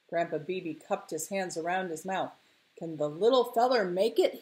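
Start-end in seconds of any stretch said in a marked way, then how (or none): 2.8-4.4 rising, projected call; booming question. Acted. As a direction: boom it out through cupped hands. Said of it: "he boomed".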